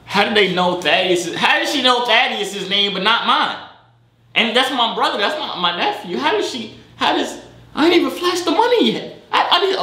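climbing, high-pitched incredulity; baffled disbelief. Real, not acted. Baffled that stranger knows his nephew.

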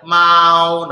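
MAU on a high, level tone; nada satu. Shown as a demonstration. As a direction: hold "Mau" long, high and flat. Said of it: "nada satu". ("Mau" nada satu, tinggi, datar dan panjang)

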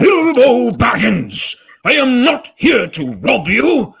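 booming, high-strained wizard roar; Gandalf. Acted. Wizard rebuking a hobbit, loud and commanding. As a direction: thunder it slowly like an angry old wizard. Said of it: "Can you talk more like Gandalf".

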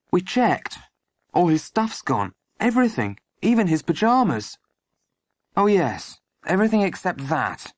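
put-on high-pitched young voice; eager boy. A narrator's reading. Boy justifying himself to the sergeant, eager.

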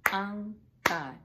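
On God in two loud beats; name clapped out. Shown as a demonstration. Teacher clapping out a pupil's name in beats. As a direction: clap the name out syllable by syllable.

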